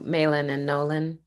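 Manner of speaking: normal talking voice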